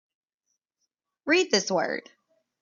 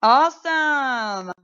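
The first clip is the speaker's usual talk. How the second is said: excited praise; 5 semitones higher, 8 dB louder, much slower than usual. Real, not acted. Teacher praising class.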